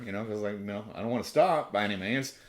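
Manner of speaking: usual speaking voice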